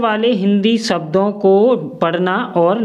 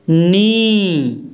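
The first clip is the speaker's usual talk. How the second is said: slow sounded out; faster than usual. Shown as a demonstration. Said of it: "तो इसमें तीनों का sound आएगा".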